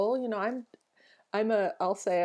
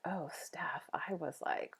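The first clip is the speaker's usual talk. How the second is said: whisper; 11 dB quieter, slower than usual. Retold or imitated. Retelling self-talk, whispered.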